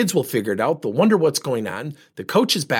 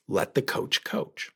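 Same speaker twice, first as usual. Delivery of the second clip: slow, near-whispered punchline; hushed advice; 3 semitones lower, 8 dB quieter, slower than usual. Retold or imitated. Speaker repeats his advice to the parents, hushed. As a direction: drop to a slow hush and let each word land.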